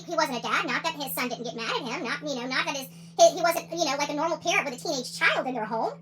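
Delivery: normal talking voice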